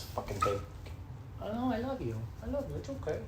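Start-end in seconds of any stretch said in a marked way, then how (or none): 1.4-3.2 cooing to a pet. Real, not acted. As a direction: coo at the puppy, much higher and softer. Talking tenderly to the dog.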